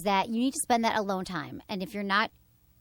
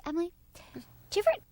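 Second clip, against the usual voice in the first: mother's voice; 8 semitones higher than usual. Retold or imitated. Daughter voicing her mother.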